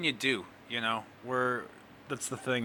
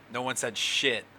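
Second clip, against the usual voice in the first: normal talking voice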